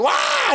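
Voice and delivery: very high-pitched comic "wah"; mock startled yelp. Priest sees the cook blown away, mock yelp. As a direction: imitate a panicked cry, hammed up. Priest's cry as kitchen explodes.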